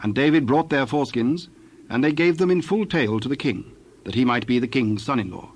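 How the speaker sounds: ordinary delivery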